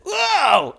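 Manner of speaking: loud, high drawn-out whoa; high wail of alarm; flailing yell. Acted. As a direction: holler as if slipping and splashing. Onyx flailing, yells out.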